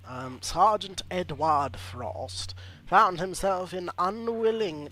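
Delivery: hammed-up trailer narration, slowing for effect; dramatic bio read. Streamer reading a game hero's backstory, in mock trailer style. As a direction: read it like a movie trailer voice-over.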